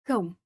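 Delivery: cão with a nasal ão diphthong, like kown through the nose; slow nasal cão, the tilde sound; Portuguese nasal vowel. Shown as a demonstration. Showing the viewer the tilde A sound in cão. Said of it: "A from cão, the O sound".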